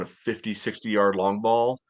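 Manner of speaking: ordinary delivery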